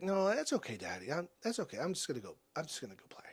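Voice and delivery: put-on soft, sinking child's voice; imitating defeated daughter. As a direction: quietly give up, voice dropping away. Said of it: "just defeated in her face and just squashed".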